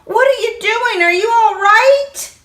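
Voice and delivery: high calling out in fear; frightened child calling. Acted. Boy calls into the pool, high scared cries. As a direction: call out scared for him. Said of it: "I yelled".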